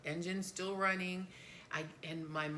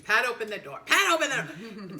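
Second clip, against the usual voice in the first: louder, urgent repeat; retold yell; 2 semitones higher, 10 dB louder than usual. Dad calling to his wife to open the door, louder. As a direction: raise it each time, getting desperate. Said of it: "He said three times".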